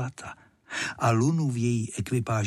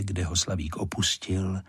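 ordinary delivery, nothing marked

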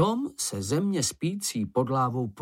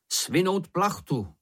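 higher, drawn-out command; measured call, pitched up; called-out order; 7 semitones higher, slower than usual. A narrator's reading. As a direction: call it slowly to the crew. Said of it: "zavolal Šňupálek".

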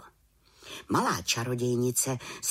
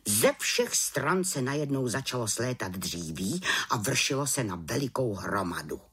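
ordinary delivery

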